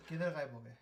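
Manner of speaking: ordinary talk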